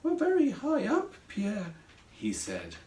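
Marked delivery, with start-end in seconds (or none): 0.0-1.7 high-pitched nervous little voice; a worried character peering down. A narrator's reading. Craig to Pierre over the basket's edge, nervous. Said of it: "looked over the edge of the basket nervously".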